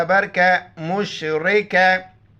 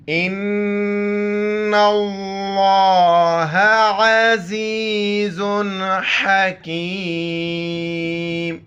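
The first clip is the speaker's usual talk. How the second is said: slow, drawn-out tilawat of the verse; Quranic recitation; much slower than usual. Shown as a demonstration. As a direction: recite the ayah slowly and melodically. (धीमी, खींचकर क़ुरान की तिलावत)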